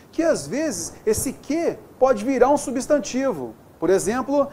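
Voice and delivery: usual speaking voice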